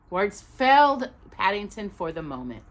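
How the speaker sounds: usual speaking voice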